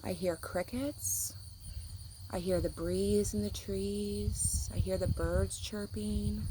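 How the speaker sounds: put-on reading to a child, calmly naming sounds; quiet listening. Shown as a demonstration. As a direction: murmur it slowly as if eyes are closed. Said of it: "I'm gonna close my eyes and I'm gonna listen".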